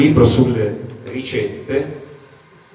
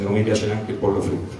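normal talking voice

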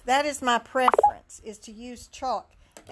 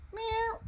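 cat meow; 11 semitones higher, 5 dB quieter than usual. Pretend.

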